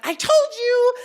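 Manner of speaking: high, loud accusing I told you; told-you exclaim. Retold or imitated. Teachers react to the burn, loud high accusing exclaim.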